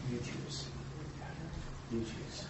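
hushed, whispered challenge. Real, not acted. Closing point said hushed.

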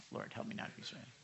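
very soft, quick whispered plea; hushed aside prayer. Real, not acted. Preacher praying not to be distracted, hushed aside. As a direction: mutter a fast prayer under the breath.

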